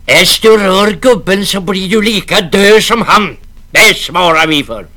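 loud menacing growl; threatening navvy. Acted. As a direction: threaten her life. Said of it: "skrek rallarna".

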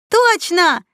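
high, loud exclamation; admiring confirmation. A narrator's reading. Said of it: "С восхищением посмотрев на девушку".

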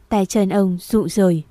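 plain reading voice

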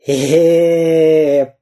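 drawn-out hoarse croak; wheezing croak. A narrator's reading. Battered spy Дырка tries to speak, croaking. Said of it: "прохрипел Дырка".

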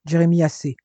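firm, frowning rebuke; stern reproof. A narrator's reading. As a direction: cut him off with mild sternness. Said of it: "fit Robert en fronçant les sourcils".

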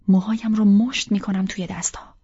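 ordinary delivery

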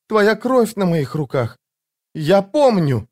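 put-on high, drawn-out anguished girl's voice; horrified confession, high and slow; anguished horror. A narrator's reading. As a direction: wail it slowly, pitched far up.

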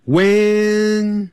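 When held long with no d sound; silent D. Shown as a demonstration. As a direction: stretch When, drop the D. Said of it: "那个D不念出来的". (D不发音，拉长When)

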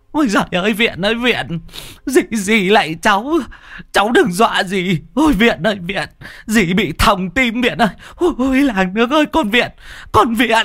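high, frantic wailing cries of terror; shrieking panic. A narrator's reading. Aunt screams in terror at the ghost by the well, high shrieking wail. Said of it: "mồm kêu lên choe choe".

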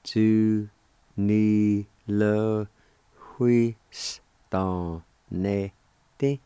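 Tu, ni, le, hui, sta, ne, te each set apart; syllable by syllable. Shown as a demonstration. Teacher showing the faint H sound, syllable by syllable. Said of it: "like this".

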